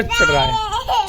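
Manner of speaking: laughing mid-sentence, pitch jumping up. Real, not acted. Amused at the dog climbing for its toy, laughing. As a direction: laugh through the words at the dog.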